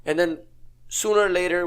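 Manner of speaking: ordinary talk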